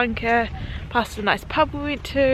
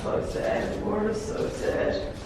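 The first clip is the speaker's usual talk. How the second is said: hushed sad; 7 dB quieter than usual. Real, not acted. Somber bunker.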